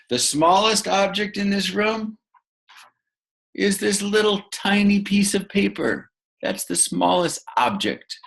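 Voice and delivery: a hint of a little squeaky voice; tiny-thing voice. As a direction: make your voice small like the paper. Teacher shows tiny piece of paper.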